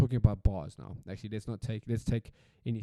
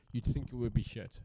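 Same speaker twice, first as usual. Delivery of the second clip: ordinary delivery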